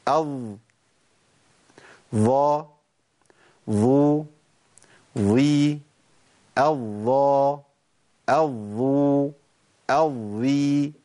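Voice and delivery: الض ظا ظو ظي الضا الظو الظي, tongue tip between the teeth, vowels stretched long; ظاء demo. Shown as a demonstration. Quran teacher drills the seven ظاء cases for students, stretched slowly. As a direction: drill each ظاء case slowly and clearly. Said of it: "ناخد بالنا صوت الضاء بيبقى عامل ازاي".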